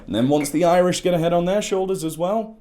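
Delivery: ordinary delivery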